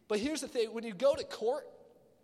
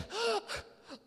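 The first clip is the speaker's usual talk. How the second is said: high startled gasp; sharp mock gasp; 13 semitones higher than usual. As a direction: suck in air in mock shock. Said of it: "you're like".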